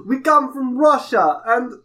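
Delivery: thick, hammed-up Russian accent. Contestant plays his Russian accent card, bad Russian accent. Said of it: "His card is Russian accent".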